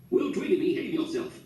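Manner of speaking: put-on high, scolding yell at the boy; mock yell from grandpa. A narrator's reading. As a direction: snap a rebuke at the grandson. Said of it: "yelled Grandpa".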